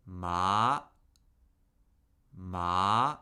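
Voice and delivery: "ma" steadily rising from mid pitch; "ma" climbing smoothly, said twice; second tone. Shown as a demonstration. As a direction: slide "ma" upward like a question. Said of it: "and steadily rising".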